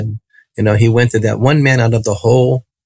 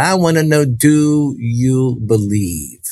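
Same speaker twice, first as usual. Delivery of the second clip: drawn-out, word-by-word challenge; deliberate questioning; much slower than usual. Retold or imitated. Healer up close asking the boy for faith, slow and deliberate. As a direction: stretch the question out very slowly.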